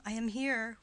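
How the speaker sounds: ordinary delivery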